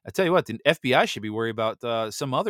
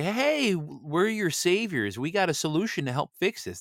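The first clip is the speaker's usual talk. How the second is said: high, slow, smug saviour voice; mocking the elites offering a solution; 8 semitones higher than usual. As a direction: play the fake rescuer, hammed up. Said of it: "and then they say".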